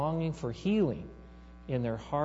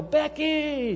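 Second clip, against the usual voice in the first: high, loud shout of a name; louder call; 13 semitones higher, 6 dB louder than usual. Retold or imitated. Mother calling her daughter again, louder high shout. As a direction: call out louder still across the rink. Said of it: "in a louder voice still".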